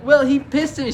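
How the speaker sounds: high, whiny mock protest; retold protest. Kid protesting to the principal, high-pitched. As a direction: blurt it as a high complaint. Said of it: "he's like".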